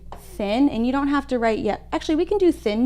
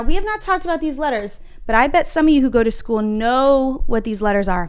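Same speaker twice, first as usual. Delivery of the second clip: ordinary talk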